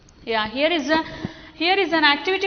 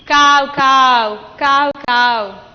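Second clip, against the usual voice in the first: loud crow cawing; 6 dB louder, much slower than usual. Shown as a demonstration. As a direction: caw it out like a crow. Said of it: "Repeat after me".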